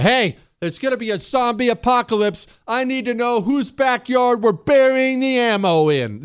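hammed-up, high-pitched alarmist rant; over-the-top prepper. Prepper warning neighbours of a zombie apocalypse, hammed-up alarm. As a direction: play an overexcited prepper accosting the neighbours. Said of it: "you don't have to come at them with a".

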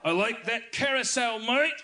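mock Aussie. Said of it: "I become a Dalek".

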